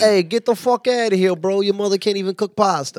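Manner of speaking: put-on brash Italian accent, talking down someone's mother. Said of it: "That's pretty good".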